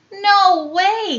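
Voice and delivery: high, loud stubborn refusal; defiant no way. Acted. Little bear refusing his mom, loud stubborn no way. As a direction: push back like a sulky kid. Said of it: "he said".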